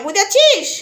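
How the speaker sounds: ordinary talk, nothing marked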